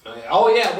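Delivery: usual speaking voice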